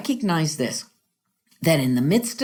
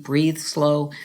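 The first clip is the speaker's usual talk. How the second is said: ordinary delivery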